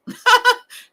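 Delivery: high, loud burst of laughter; real amused cackle. Real, not acted. Laughing after reassuring the viewer.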